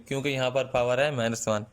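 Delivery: normal talking voice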